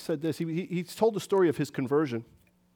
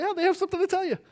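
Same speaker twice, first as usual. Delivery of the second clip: higher, teasing sing-song introduction; playful sing-song; 12 semitones higher, much faster than usual. Retold or imitated. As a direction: hand the talking over to your partner with a grin. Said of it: "knock on the door and say".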